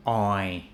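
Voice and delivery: oil cut to 'oi'; imitated oil ending on a glide; Thai-style pronunciation. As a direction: drop the L from oil. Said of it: "So in Thailand, we have".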